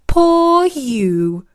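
high, drawn-out sympathetic coo; oh-you-poor-thing commiseration. A narrator's reading. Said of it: "said Isis's mum sympathetically".

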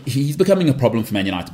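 usual speaking voice

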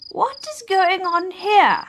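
put-on high-pitched old woman, questioning. A narrator's reading. Old woman to quarrelling animals, high and questioning. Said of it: "she asked".

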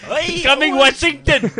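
high, loud hammed-up call; playful high hail from the ground; mock radio shout. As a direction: yell it out like calling a far station. Mock calling to Washington.

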